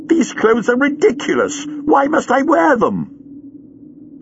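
put-on high, slow, indignant young warrior woman; Leela irritated. A narrator's reading. Leela to the Doctor, high and indignant. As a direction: grumble at the silly clothes.

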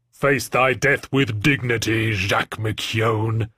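slow archaic menacing threat; solemn, slow villain pronouncement; alien warlord voice. A narrator's reading. Villain Angelo speaking.

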